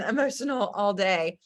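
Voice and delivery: normal talking voice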